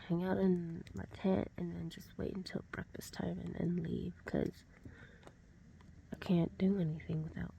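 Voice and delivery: soft hushed whisper. Real, not acted. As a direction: whisper it under your breath. Early morning tent whisper.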